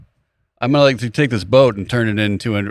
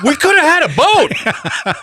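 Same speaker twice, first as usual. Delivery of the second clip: high, slow drawn-out wail; hammed-up regret stretched long; mock lament; 4 dB louder, much slower than usual. Players mourn the boat they passed up, slow high mock wail.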